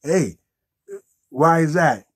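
normal talking voice

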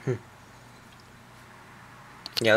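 usual speaking voice

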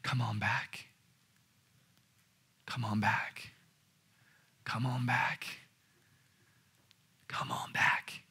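soft, half-voiced beckoning; hushed, patient call to return; whispering. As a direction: whisper it, with long pauses between. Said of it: "that voice that's whispering".

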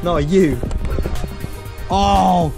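ordinary talk

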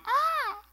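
high-pitched drawn-out "ah"; high awkward squeal. Acted. Preacher mimicking someone left alone in the stands, high squeal. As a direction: let out a thin, very high "ah".

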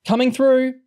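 high, loud call to clear the way; shout. A narrator's reading. Girls carrying a stretcher through the crowd, shouting. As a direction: holler to get past. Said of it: "a couple of girls shouted".

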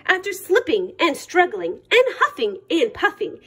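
ordinary delivery

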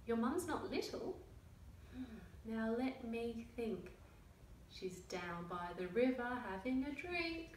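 lively picture-book read-aloud with character voices; reading to children. A narrator's reading. Reading a picture book to young children, lively storytelling. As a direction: tell the story to little listeners.

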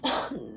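low, heavy sigh; downhearted exhale; weary sigh. Acted. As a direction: sigh before admitting defeat. Acted sigh in sad monologue.

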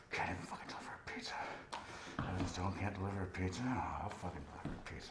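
hushed, fast, seething under breath; bitter whisper. Acted. Humiliated pizza boy talking himself up alone, whispered and bitter. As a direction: mutter it to yourself in defeat.

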